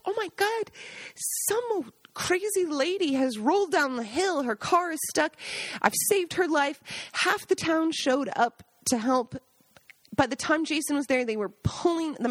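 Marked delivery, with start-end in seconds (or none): 0.0-6.8 high, breathless excitement; worked up. Retold or imitated. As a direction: blurt it out all worked up. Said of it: "was so worked up".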